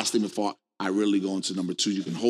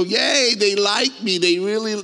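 high, loud mock cheering; mock delight; 9 semitones higher, 4 dB louder than usual. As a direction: gush quickly like someone thrilled to be liked. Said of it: "Can you imagine that person go".

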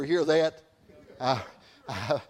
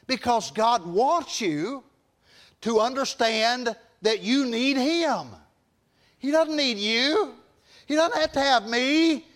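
raised-pitch pulpit exhortation; impassioned preaching; 7 semitones higher than usual. Real, not acted. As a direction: press each point home in a high preacher's cadence.

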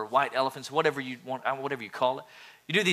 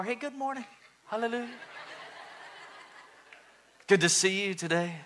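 mock-sugary high greeting, soft; fake sweet churchgoer; 6 semitones higher, 4 dB quieter, faster than usual. Mocking the churchgoer who arrives after a bad drive with a sugary greeting. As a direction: ham up a pious church-lobby hello. Churchgoer putting on holy face.

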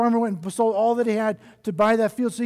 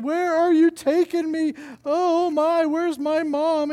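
high, anxious cry, slowing at the end; frightened baby bird; 9 semitones higher, slower than usual. Acted. Baby bird carried off by the snort, high and frightened.

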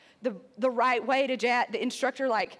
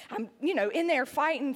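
ordinary talk, nothing marked

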